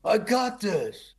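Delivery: high, slow grumpy mock line; a hint of a grousy actor; fake mad Nick. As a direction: grumble it, drawn out. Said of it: "He's always angry".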